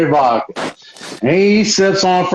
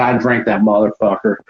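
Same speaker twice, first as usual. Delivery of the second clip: normal talking voice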